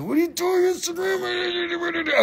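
mock-furious high-pitched holler; angry face outburst. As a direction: let an exasperated yell run away into garble. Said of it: "I had the angry face".

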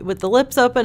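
usual speaking voice

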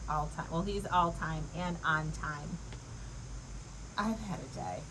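ordinary talk

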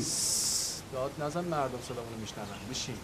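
hushed urgent warning. Acted. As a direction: hush him so people won't hear. Hushing boss in public café.

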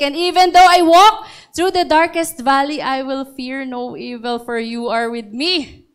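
psalm said with real feeling rising; preacher moved while reciting Psalm 23; emotional recitation. Real, not acted. Reciting favorite psalm, near tears.

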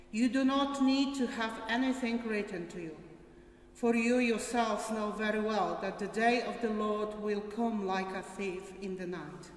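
ordinary delivery

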